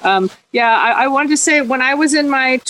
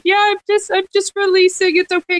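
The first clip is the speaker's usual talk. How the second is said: breezy reassurance; 9 semitones higher than usual. Retold or imitated. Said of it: "I'm like".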